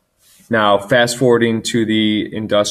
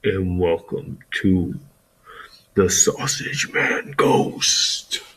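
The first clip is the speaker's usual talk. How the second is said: slow spooky whisper; sneering panto villain; 2 semitones lower, slower than usual. Acted. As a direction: hiss the title like a villain. Spooky intro of ghost story.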